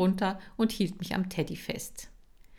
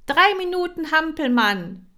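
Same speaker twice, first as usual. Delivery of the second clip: called command; 10 semitones higher, 9 dB louder than usual. Acted. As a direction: call the exercise to the class. Kira ruft Übungen. (laut und hoch gerufenes Kommando)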